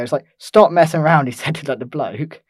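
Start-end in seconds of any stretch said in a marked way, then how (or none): 0.4-1.3 loud, higher, exasperated scolding; angry telling-off. Retold or imitated. Man scolding the other bloke, loud and fed up. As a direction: bark it at the annoying bloke. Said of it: "the dude was, like, having a go".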